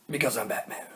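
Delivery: quiet impression of a caped crusader; Batman. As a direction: say it softly as the masked hero. Quoting Batman as the Batman figure.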